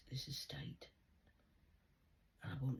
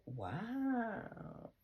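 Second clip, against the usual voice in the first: high, stretched sarcastic wow; mock amazement; 12 semitones higher than usual. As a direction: pitch it way up in fake astonishment. Sarcastic exclamation.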